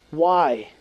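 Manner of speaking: ordinary delivery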